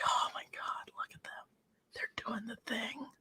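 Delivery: hushed, starstruck whisper; awed whisper. Retold or imitated. Girl ringside whispering in awe at the wrestlers. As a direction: whisper in awe at your heroes.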